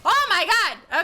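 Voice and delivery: high, loud exclamation of shock; startled outburst. Real, not acted. Streamer caught out mid-fight, loud startled cry. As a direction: burst out in loud, high alarm.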